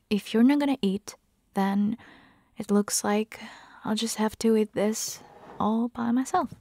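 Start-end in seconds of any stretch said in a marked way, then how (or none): none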